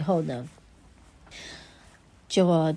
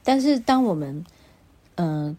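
ordinary delivery

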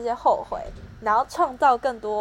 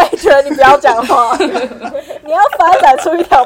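laughing; 2 semitones higher, 12 dB louder than usual. Real, not acted. Laughing at friend's idea.

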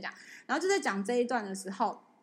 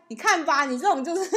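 teasing told-you-so; 5 semitones higher, 8 dB louder than usual. Retold or imitated. Friends teasing her, retold.

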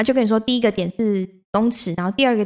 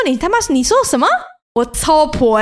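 fast, higher and louder, half-joking indignation; mock outrage; 5 semitones higher, 3 dB louder than usual. Answering the friend who called her chou po, mock outrage. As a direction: fire it back like you can't believe the insult.

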